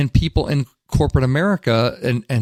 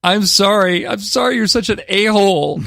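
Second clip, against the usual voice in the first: high, sing-out sarcastic apology; hammed-up insincere sorry that turns into an insult; mock apology; 8 semitones higher, 3 dB louder than usual. Radio host parodying a blame-shifting friend, mock apology.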